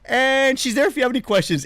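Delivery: high, bright customer-service cover-up; smooth it over in a high helpful tone; sheepish salesman voice. Retold or imitated. Caught complaining, he turns to the lady, high and sheepish. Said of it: "And I go".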